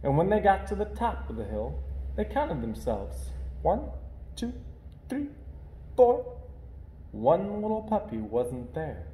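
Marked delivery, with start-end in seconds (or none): none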